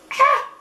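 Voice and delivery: sudden high shriek of shock; a real shriek as the shadow falls out; startled shriek. Real, not acted. Reviewer shrieks as eyeshadow pops from pan, startled high shriek.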